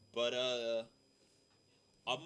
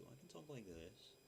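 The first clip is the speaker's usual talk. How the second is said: quiet voice; 4 semitones higher, 15 dB quieter than usual. Shown as a demonstration. Streamer showing viewers how quietly he could talk, soft and hushed. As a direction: pull it right down as if in a hotel room. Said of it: "but I don't need to".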